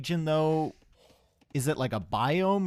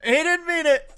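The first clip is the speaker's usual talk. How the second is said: much higher, loud shouted plea; pleading shout; 10 dB louder than usual. Real, not acted. Caster begging Valesa to spare the donkey, shouting. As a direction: yell at the screen, begging him to stop.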